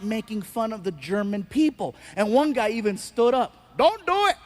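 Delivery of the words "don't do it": high loud pleading shout; pleading yell. Retold or imitated. Audience member begging the comedian not to mock Germans, loud pleading. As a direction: beg him to stop.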